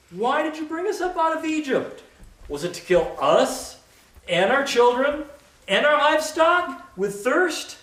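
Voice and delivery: high, whining complaint; voicing the grumbling people. Thirsty crowd grumbling at Moses, high whining voice. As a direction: whine like thirsty angry people.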